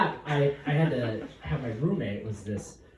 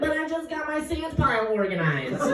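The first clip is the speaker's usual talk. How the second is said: much higher whiny voice; whiny excuse; 13 semitones higher than usual. Retold or imitated. Engineer pleading with officer in the trenches. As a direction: plead like a fussy child. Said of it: "I'd be like".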